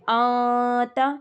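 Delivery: آتا stretched very slowly, the sound hidden in the nose; ikhfa demo. Shown as a demonstration. Teacher drawing out آتا to show ikhfa to the student. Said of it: "چھپانا مطلب آواز ایک دم چھپ جاتی".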